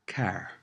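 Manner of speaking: car with the final R sounded. Shown as a demonstration. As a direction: say car, pronouncing its R at the end. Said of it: "The R is always sounded out".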